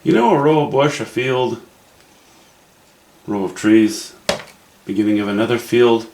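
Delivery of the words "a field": normal talking voice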